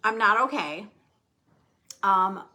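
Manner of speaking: ordinary talk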